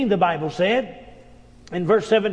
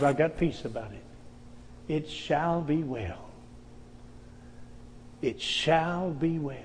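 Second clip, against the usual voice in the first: soft, slow, lower assurance; calm peace; 4 semitones lower, 11 dB quieter than usual. Acted. Grieving mother telling her husband all is well, calm. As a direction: settle into quiet faith. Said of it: "her answer was".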